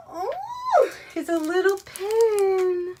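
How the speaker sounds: high, loud, drawn-out cooing; thrilled high-pitched coo; delighted squeal. Real, not acted. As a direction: squeal it slowly over something tiny and cute. Delighted at finding a pin.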